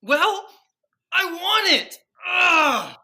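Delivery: loud, high whining shout; childish tantrum. Acted. Husband demands relaxation from wife, shouted tantrum. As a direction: wail it like a thwarted child.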